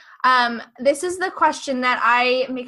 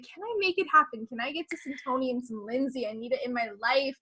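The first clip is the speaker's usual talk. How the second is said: normal talking voice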